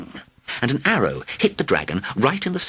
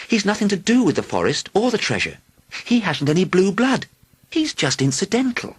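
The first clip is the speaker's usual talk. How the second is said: put-on higher, light young princess pleading; 11 semitones higher than usual. A narrator's reading. Princess voiced in story.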